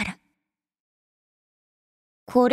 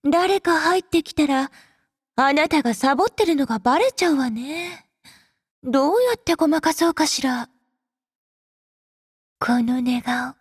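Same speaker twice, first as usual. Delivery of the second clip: ordinary talk, nothing marked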